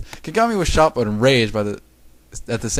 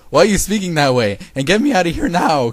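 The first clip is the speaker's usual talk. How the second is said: higher, louder indignant demand; yelled; 7 semitones higher, 4 dB louder than usual. A narrator's reading. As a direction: yell it with exasperation. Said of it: "Kagami yelled".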